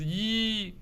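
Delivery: "一" as high level first tone yī. Shown as a demonstration. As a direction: hold "一" high and flat. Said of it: "一 has three tones, which is".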